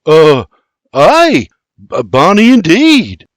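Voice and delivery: flustered Scots lad stammering. Acted. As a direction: trip over the words in surprise. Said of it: "William stammers".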